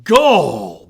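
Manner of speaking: loud booming Gall; bellow. A narrator's reading. Husband bellowing at his son's foolish dream, loud. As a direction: bellow the word in anger. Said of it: "bellowed Red".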